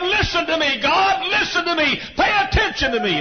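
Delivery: very high, impassioned shouted plea; crying and shouting. Retold or imitated. Preacher as the prophet shouting to God, crying out. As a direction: shout up to heaven, demanding a hearing. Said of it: "When I cry and shout".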